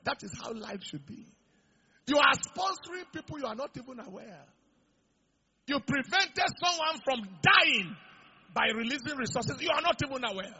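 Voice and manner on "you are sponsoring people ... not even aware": raised, emphatic preaching voice; rising exhortation. Real, not acted. Preacher urging the congregation, half-shouted. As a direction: press the point home to the congregation.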